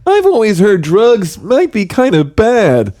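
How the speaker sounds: put-on cheery kids' show bear voice; friendly bear host. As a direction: welcome the kids into the big blue house. Bear sketch continues.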